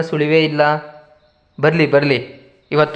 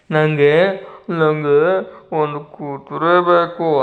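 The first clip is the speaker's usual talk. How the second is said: put-on slow, whimpering little child voice; crying child; slower than usual. Tenali as a child crying for a horse, slow and whimpering. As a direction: whine it out like a tearful kid. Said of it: "ಮತ್ತೆ ಮಗುವಿನ ಹಾಗೆ ಬದಲಾದ".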